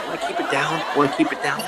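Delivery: embarrassed man shushing a stranger in the park; hushed plea. Retold or imitated. Shushing a man yelling at him.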